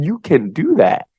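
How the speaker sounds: high, drawn-out encouragement; friendly idea voice. Acted. Idea voice cheering a person on, friendly encouragement. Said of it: "is so friendly and nice".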